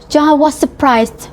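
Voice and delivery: usual speaking voice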